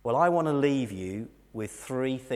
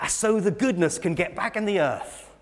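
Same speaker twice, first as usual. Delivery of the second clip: imitating dad's Norfolk speech, higher-pitched; raised-pitch Norfolk accent; 7 semitones higher, 5 dB louder than usual. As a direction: answer like a Norfolk farmer. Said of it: "in his Norfolk accent".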